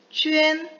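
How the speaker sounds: 쥐엔 held high and level as "qüen"; 쥐엔 drawn out as a high, level model syllable; model pinyin reading. Shown as a demonstration. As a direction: chant 쥐엔 on one high, sustained tone with a ü glide. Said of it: "이런 식으로 읽어요".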